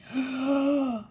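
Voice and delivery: big, drawn-out noisy yawn; monstrous yawn. A narrator's reading. Sleepy creature stretched out on the porch, monstrous yawn. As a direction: yawn hugely, letting the breath pour out. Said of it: "with a monstrous yawn".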